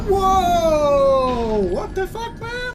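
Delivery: mock a startled player crying out, pitch climbing; shocked yell. Retelling his shock at the dragon, a startled cry. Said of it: "I was like".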